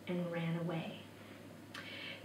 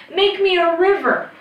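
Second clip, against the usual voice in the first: high, loud magic command; boy's raised, commanding cry; urgent command; 9 semitones higher, 6 dB louder than usual. A narrator's reading. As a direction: call out the spell urgently. Boy commands the charm.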